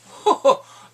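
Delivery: loud high laugh; sudden delighted laugh; burst of laughter. Real, not acted. Laughing at Euron's insult.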